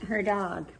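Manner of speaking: usual speaking voice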